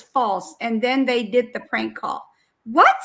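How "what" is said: loud, high rising question; startled disbelief. Real, not acted. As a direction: jump up in pitch, incredulous. Reacting to the prank claim.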